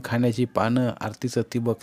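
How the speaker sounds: ordinary delivery, nothing marked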